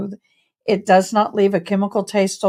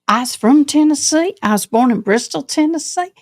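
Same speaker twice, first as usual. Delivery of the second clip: put-on Tennessee accent; 5 semitones higher than usual. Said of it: "I can sure get that accent on".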